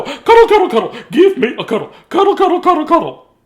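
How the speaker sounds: very high, loud, repeated cries; manic demand. A narrator's reading. Big man chasing Dudley, manic high shouting. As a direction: chant it frantically at the top of the voice.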